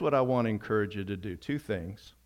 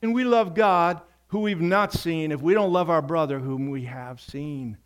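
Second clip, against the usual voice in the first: raised, emphatic preaching; 7 semitones higher, 6 dB louder than usual. Acted. Pastor urging the congregation to love, preaching hard. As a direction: drive the point home from the pulpit.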